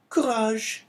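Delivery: put-on very high, tremulous girl's voice; tearful young girl. A narrator's reading. Daughter in father's arms, high and tearful.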